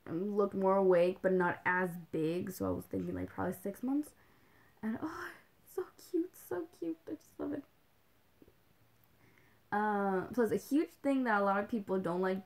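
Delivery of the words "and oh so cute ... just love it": gushing. Real, not acted. Gushing about babies.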